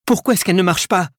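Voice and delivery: fast, high-pitched frantic question; desperate. A narrator's reading. Edgar struggling with the church bell, desperate. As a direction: ask it in rising desperation. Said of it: "dit-il au désespoir".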